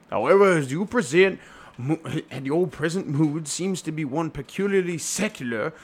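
put-on clergyman accent, theatrical; hammed-up Doctor Chasuble. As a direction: intone it as a comic reverend.

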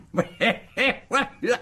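high, pleased cackle; high wheezy chortle; gloating laugh. Acted. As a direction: laugh with triumph.

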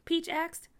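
ordinary delivery

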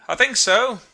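high, loud call across water; hailing back. A narrator's reading. Narrator hailing back to the steamer after the crash, high shout. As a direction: shout a reply to the other ship. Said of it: "hailed back".

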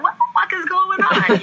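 high, slow, exasperated boss through the glass. Retold or imitated. Boss behind the glass window demanding why she won't answer, exasperated.

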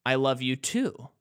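ordinary talk, nothing marked

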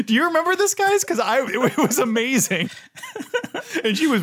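high laughing voice. Real, not acted. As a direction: laugh through the words. Laughing while recalling.

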